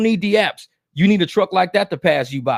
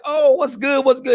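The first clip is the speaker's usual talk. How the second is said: friendly greeting; 7 semitones higher than usual. Retold or imitated. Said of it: "I'm like".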